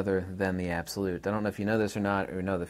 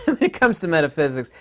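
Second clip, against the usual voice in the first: laughing at own joke; 8 semitones higher, 8 dB louder than usual. Real, not acted. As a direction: chuckle through the words, a bit higher and louder. Laughing at his own dry joke.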